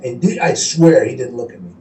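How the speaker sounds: ordinary talk, nothing marked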